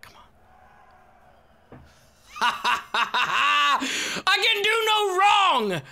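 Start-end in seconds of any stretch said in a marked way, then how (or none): 2.4-5.8 very slow, laughing, high boast; mock grandiose. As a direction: savour every word like a smug king. Gloating after escaping charges.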